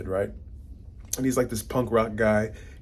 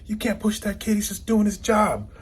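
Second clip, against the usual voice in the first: high, heated mock yell at the pusher; retold yelling; 10 semitones higher than usual. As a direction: retell the bystander's outburst, much higher. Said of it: "he starts yelling".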